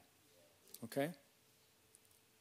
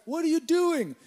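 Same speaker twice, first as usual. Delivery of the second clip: high, loud call from a car; 11 semitones higher, 7 dB louder than usual. Retold or imitated. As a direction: holler at a friend from the driveway. Pastor honks and calls.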